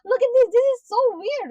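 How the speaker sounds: high, loud mocking imitation of the clueless boy; high mock voice of a clueless teen; mocking naive voice. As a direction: ham up his cheerful naivety. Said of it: "been like".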